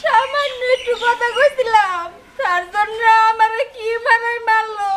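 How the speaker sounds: slow, drawn-out tearful lament; wailing complaint. Acted. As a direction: sob out your grievance, stretching words. Sobbing complaint to son. (কেঁদে কেঁদে নালিশ)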